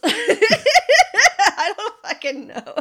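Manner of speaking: loud laughing, slow. Real, not acted. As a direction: laugh through the words. Laughing at own bad accent.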